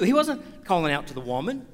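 normal talking voice

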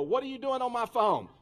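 loud, high-pitched angry demand; acted-out outburst at a stranger; snapped. Man to girl answering his lost phone, snapping. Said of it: "I snapped".

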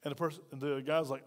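usual speaking voice

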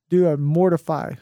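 normal talking voice

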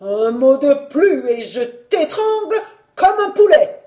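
loud, high, strangling threat; frothing rage. A narrator's reading. Husband seizing his wife by the throat, frothing rage. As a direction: shout the threat as if foaming with fury. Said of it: "il fit l'écume aux lèvres".

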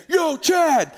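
high, loud holler across a room; boisterous call. Retold or imitated. Kristen greets the pastor as he walks in, boisterous call. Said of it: "she is a force of nature".

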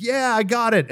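high, giddy announcement; triumphant reply. Retold or imitated. Traveler holding up his recovered passport, high and triumphant. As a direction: call it out as if holding up a prize.

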